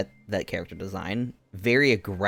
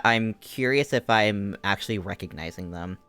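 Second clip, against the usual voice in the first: ordinary talk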